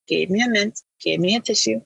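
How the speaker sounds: ordinary talk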